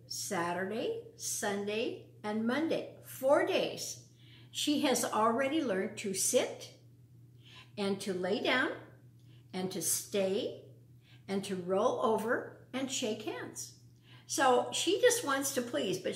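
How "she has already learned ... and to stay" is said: ordinary delivery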